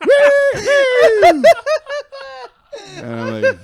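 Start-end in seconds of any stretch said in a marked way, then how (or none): 0.1-1.4 very loud, high woo-hoo; celebratory whoop. Retold or imitated. Hunters hollering over a found deer, piercing whoop. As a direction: whoop it out like a wild party. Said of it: "and then I hear".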